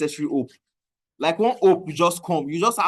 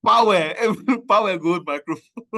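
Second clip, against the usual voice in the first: laughing; 5 semitones higher, 4 dB louder than usual. Real, not acted. Laughing while mocking news.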